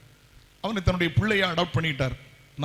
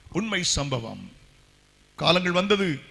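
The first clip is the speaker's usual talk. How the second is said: ordinary talk, nothing marked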